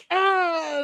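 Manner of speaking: high, held mock yell; imitated answering yell. Retelling rival wrestler's answer, high mock yell. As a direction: yell high without full volume.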